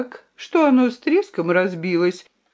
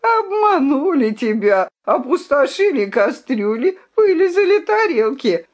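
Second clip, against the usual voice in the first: high, jeering taunt; mocking; 6 semitones higher than usual. A narrator's reading. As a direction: rub his nose in it. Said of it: "насмехался рыцарь".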